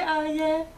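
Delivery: Aye said the Spanish way; Spanish pronunciation. Real, not acted. Greeting the viewer who requested the video, Spanish pronunciation. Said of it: "pronouncing it the Spanish way".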